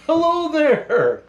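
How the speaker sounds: high, slow jolly Santa ho-ho-ho; Santa laugh. Acted. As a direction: boom out a cheery Santa greeting. Santa greets kids jovially.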